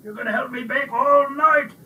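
loud, high roar of excitement. A narrator's reading. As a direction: roar the orders with glee.

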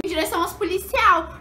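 usual speaking voice

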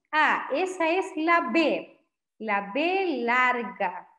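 "la B larga" estirado, muy despacio; explicación lenta. Shown as a demonstration. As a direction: decir la letra con calma didáctica. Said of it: "Nosotros decimos". (cita lenta de cómo se dice "B larga")

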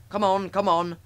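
loud, high urging; puffing engine. A narrator's reading. Gordon urging the coaches to move, loud puffing. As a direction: chug the words out forcefully. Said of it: "puffed Gordon to the coaches".

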